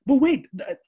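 high, loud burst of surprise; sudden interruption. Real, not acted. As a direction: cut in sharply, stopping yourself.

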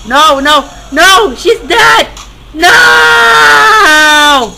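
high, loud cries of dismay; anguished shout. Real, not acted. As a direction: wail in protest at a death, ending drawn out. Character dies in game.

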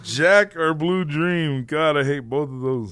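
slow, dragged-out dismay. Real, not acted. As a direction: groan the choices out slowly. Groaning over a hard choice.

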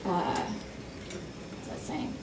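very soft unvoiced mutter; whispered aside. Real, not acted. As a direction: whisper it to yourself, slowly.

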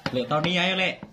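high, loud call. Real, not acted. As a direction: rouse him with a call. Calling sleepy uncle again.

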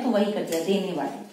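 ordinary delivery

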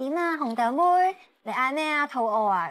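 put-on cooing baby talk to a puppy; baby talk to a dog. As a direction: coo like talking to a toddler. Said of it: "會用返一個小朋友通啲嘅說話".

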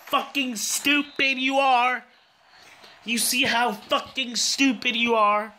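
high, slow, drawn-out berating; real fed-up scolding; exasperated scolding. Real, not acted. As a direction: spell out the insult at the screen. Scolding his player's mistake.

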